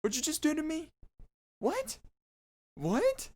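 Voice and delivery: squeaky high, confused yelps; startled robber. Pretend. Robber being tickled squeaks high in confusion. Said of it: "you're gonna be like".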